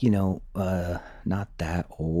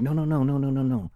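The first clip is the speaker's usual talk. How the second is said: a lightly voiced, higher insistent denial; playful mock protest, pitched up; 8 semitones higher than usual. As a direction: mimic the commander brushing it off. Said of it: "he'd be like".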